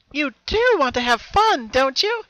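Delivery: very high, loud, slow coaxing sing-song; an unseen double luring Pinkie to play; cheerful teasing. A narrator's reading. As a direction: tempt her in a sugary voice. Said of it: "the cheerful voice that echoed".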